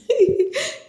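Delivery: short, high giggle; quick real laugh. Real, not acted. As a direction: giggle after dropping a reveal. Amused by her own reveal.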